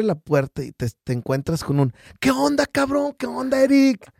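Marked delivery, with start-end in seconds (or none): 2.2-4.1 greeting called out high and eager; saludo efusivo. Retold or imitated. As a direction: hail a buddy at the door with a big high hey. Amigo saluda efusivo en la puerta. (saludo efusivo y agudo)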